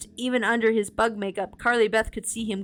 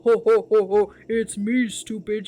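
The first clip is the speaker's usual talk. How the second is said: deep rumbling laugh; 5 dB louder than usual. Acted. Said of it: "laughter came out in a deep rumble".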